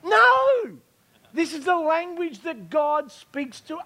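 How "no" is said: sudden high loud shout of no; emphatic no. Real, not acted. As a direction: cut it off with a big high no. Rejecting striving to earn fruit.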